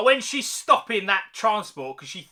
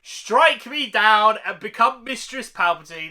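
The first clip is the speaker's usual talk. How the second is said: slow, louder mock-villain line, lightly voiced; Palpatine impression; 3 semitones higher, 5 dB louder than usual. Reviewer reciting his favourite Palpatine line, slow hammy villain. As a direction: savour the Emperor's taunt slowly. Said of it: "when he said".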